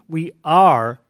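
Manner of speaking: "are" punched loud; loud pressed "are" for the present tense; stress on are. Shown as a demonstration. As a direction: hit the word "are" hard. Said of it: "present tense".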